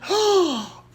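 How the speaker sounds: high loud theatrical gasp; dramatic gasp. Pretend. Acting out a breakthrough, high theatrical gasp. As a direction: gasp like a revelation.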